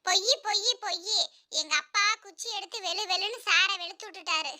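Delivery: soft, small taunting voice; teasing schoolboy. Acted. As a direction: tease the teacher like a tattling child. Pupil gleefully exposing teacher's lie. (கிண்டல் செய்யும் சிறுவனின் மெல்லிய குரல்)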